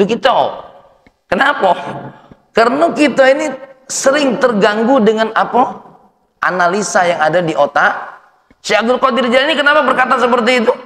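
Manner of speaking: high, loud sermon voice, pushing every phrase; rousing pulpit delivery; fiery preaching. Acted. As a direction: preach it out to the hall. Preacher raises voice again.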